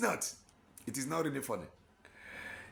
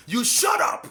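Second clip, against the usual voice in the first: sharp, loud, high rebuke; angry shout; 14 semitones higher, 11 dB louder than usual. Real, not acted. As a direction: slam the enemy into silence. Preacher scolding the enemies.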